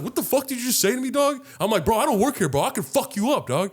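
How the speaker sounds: high-pitched, agitated comeback, acted out; heated challenge. Bystander threatening the rude customer back, heated retort. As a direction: square up to him with indignant energy.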